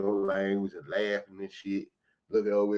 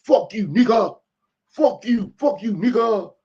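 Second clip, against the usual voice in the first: furious, much higher and louder yelling; retold furious shouting at a store clerk; angry shout; 5 semitones higher, 7 dB louder than usual. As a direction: yell it at the cashier, ready to fight. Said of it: "threw the quarter on the counter and say".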